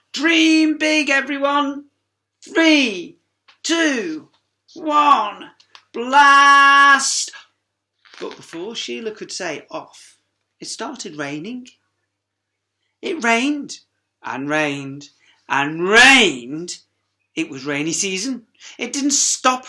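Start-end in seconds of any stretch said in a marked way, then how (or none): 0.1-7.3 high, loud rousing countdown; countdown call. Acted. Launch countdown. 13.0-16.8 slow, drawn out. A narrator's reading. Drawn out narration.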